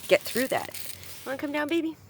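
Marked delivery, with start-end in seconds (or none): 1.3-2.0 cooing to a pet. Real, not acted. Calling her old cat.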